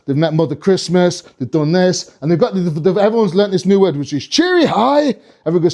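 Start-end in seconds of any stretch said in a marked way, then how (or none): 4.3-5.2 high, slow singsong; elven greeting. Acted. Elves greeting everyone, high singsong. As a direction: chirp it brightly, pitched up.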